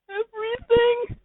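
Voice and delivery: very high, loud crying squeak; high whining voice. Acted. The listener echoing Pimpich in a high, loud crying squeak. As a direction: squeal the word in disbelief.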